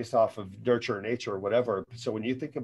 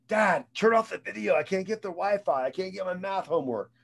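put-on complaining kid; 6 semitones higher than usual. Father voicing his complaining kid.

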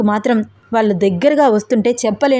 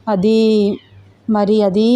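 faltering; slower than usual. A narrator's reading. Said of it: "తడబడుతోంది Shraddha".

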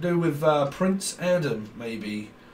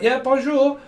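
squeaky "Yeah, bonjour."; chirpy high "bonjour" like a nervous teen; high mock French reply; 11 semitones higher, 7 dB louder than usual.

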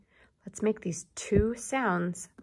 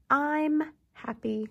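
slow and bright; 7 semitones higher, 4 dB louder, slower than usual. Shown as a demonstration.

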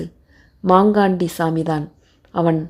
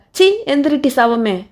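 higher-pitched, disgusted command; angry scolding; 6 semitones higher than usual. A narrator's reading. Perumal kicks the reluctant woman, angry scolding order. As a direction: curse her to get up.

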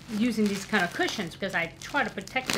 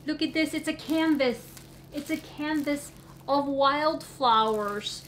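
soft gasp into higher, slow awe; overwhelmed; 4 semitones higher, 3 dB quieter than usual. Real, not acted. Unwrapping the canvas from a friend, awed. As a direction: breathe it out amazed.

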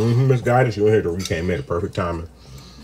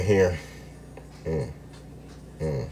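ordinary talk, nothing marked

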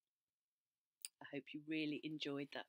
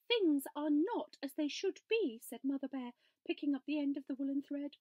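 lightly higher mama bear voice; a hint of a worried mother bear; mama bear voice, a touch raised; 8 semitones higher, 7 dB louder than usual. A narrator's reading.

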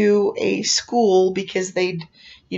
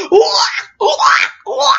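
short mock wails, repeated; imitated scream; 12 semitones higher, 5 dB louder than usual. Young man running around in circles, mock screaming. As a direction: mimic someone freaking out in separate yelps. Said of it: "He's screaming".

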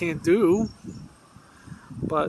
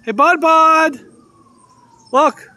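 high, loud call for attention; pitched-up pet call; 12 semitones higher, 12 dB louder, slower than usual. Real, not acted. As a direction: call out to get an animal's attention. Calling squirrel to a pecan.

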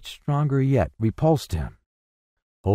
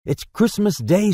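high, rising, marveling exclamation; delighted realization; 8 semitones higher than usual. A narrator's reading. Scrooge learns it is Christmas, delighted. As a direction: marvel at the news. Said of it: "said Scrooge to".

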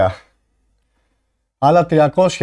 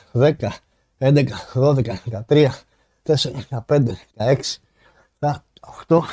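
counting through exertion. Real, not acted. Streamer counting push-ups for viewers, strained with effort.

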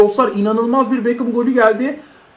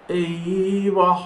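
low, drawn-out dismay; dismayed groan; 5 semitones lower, 5 dB quieter than usual. Real, not acted. Gamer watching a move go wrong, dismayed. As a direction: let the word sag low and long.

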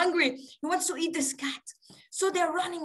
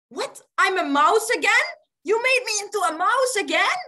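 raised pitch, rising disbelief; incredulous mouse; 4 semitones higher, 5 dB louder than usual. Acted. Transformed tiger to the yogi, incredulous. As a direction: question it in indignant shock.

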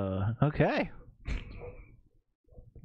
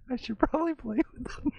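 giggly and fast; 12 semitones higher, faster than usual. Real, not acted. Laughing while speaking.

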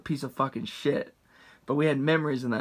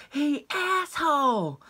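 high, slow mock angry woman; a high, drawn-out mock yell at the drunk driver; angry lady; 12 semitones higher, much slower than usual. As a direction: drag out the insult like an irate mom. Angry mom at drive-thru.

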